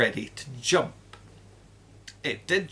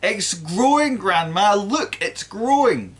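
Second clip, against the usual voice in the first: put-on higher, louder excited boy; boy shouting up to his grandma; excited yell; 7 semitones higher, 6 dB louder than usual. A narrator's reading. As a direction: call it out, thrilled. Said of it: "he yelled".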